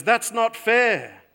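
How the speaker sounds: student protesting to the professor, indignant. Retold or imitated. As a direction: cry out that it's unfair. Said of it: "a voice of protest".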